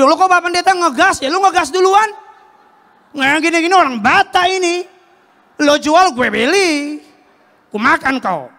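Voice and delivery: high, loud heated retort; the pastor losing his temper. Retold or imitated. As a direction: fire back like a street fight. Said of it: "Ya dia emosi, gue juga emosi dong".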